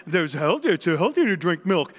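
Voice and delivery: low, muddled mock voice, stumbling over the words; mocking health-food excuse. Mimicking people who defend chocolate as healthy, mocking low voice. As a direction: parody a dopey know-it-all making excuses. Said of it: "Everything that they say is healthy".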